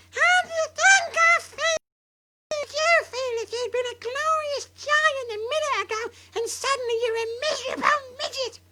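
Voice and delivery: put-on high, loud crying old-woman rant; furious grandma. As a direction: rage at shrinking, near tears.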